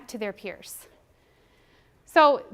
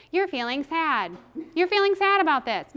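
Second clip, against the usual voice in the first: fakey patronizing; 3 semitones higher, 4 dB louder than usual. Shown as a demonstration. Teacher wrongly validating a child, mock patronizing sympathy. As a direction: overdo the concern, pitch lifting on the repeat. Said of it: "You can't be fakey".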